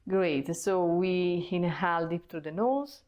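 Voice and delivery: calm, paced breathing instructions; guided breathwork. Acted. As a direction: coach the breath step by step, unhurried. Leads a breathing exercise.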